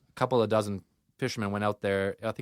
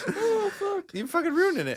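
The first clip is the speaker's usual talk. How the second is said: laughing complaint. Real, not acted. Mock complaint at interruptions.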